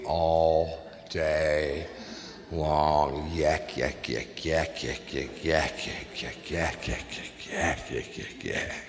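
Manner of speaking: mocking the chatty fan, dwindling to a mumble; mock chatter. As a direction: mimic a tireless talker trailing away.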